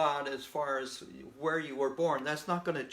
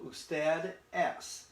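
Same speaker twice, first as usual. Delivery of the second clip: usted es very slowly, each syllable apart; slow model phrase; 4 semitones higher than usual. Shown as a demonstration. Teacher drawing out usted es for students. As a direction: stretch it for the learners. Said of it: "it's a formal you".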